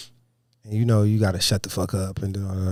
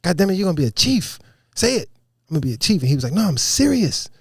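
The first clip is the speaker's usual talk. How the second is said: raised-pitch, insistent pep talk; retold exhortation; 8 semitones higher, faster than usual. Chief pushing a sailor to say it, insistent. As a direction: imitate a chief demanding they repeat it.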